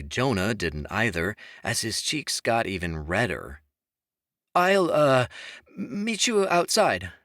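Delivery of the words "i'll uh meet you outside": high, nervous stammer; flustered stutter. A narrator's reading. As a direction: stumble over the words. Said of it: "Jonah stuttered".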